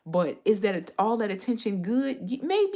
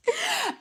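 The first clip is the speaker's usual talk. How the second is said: short high-pitched laugh; real laugh. Real, not acted. Vlogger cracks up at her own waiter story, high laugh.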